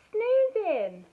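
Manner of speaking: high, loud, drawn-out punchline; triumphant reveal. A narrator's reading. Animals praising Sloth's talent, triumphant reveal. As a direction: proclaim the final word grandly.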